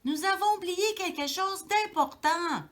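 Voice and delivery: high, loud urgent call; high, loud little mouse voice; crying out. A narrator's reading. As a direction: call out the news so he hears. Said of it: "s'écrie-t-il".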